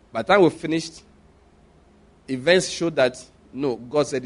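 ordinary talk, nothing marked

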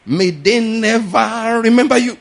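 loud, slow, high pulpit declaration; preaching. Shown as a demonstration. Pastor adding preaching to the curse, loud and slow. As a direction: thunder it like a preacher. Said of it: "Let me add some preaching to it".

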